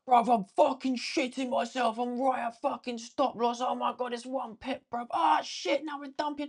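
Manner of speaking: high, frantic panic at the stop loss; panicked journal voice. Retold or imitated. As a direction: squeal it out like you're watching the trade die. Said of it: "I'm like".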